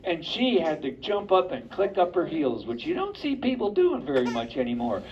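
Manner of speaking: ordinary delivery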